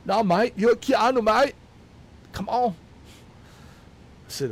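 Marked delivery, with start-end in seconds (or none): 0.1-2.7 higher, louder mock British pleading; hammed-up excited British fan begging; British fan asking Keanu for a hug, high mock accent. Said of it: "some British guy".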